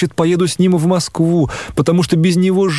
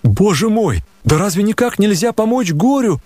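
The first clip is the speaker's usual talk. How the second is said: desperate exclamation; 7 semitones higher than usual. A narrator's reading. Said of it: "закричал я ... в совершенном отчаянии".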